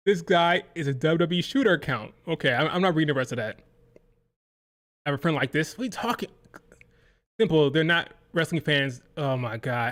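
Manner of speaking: ordinary talk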